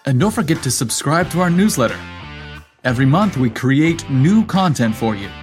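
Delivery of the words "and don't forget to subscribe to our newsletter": plain reading voice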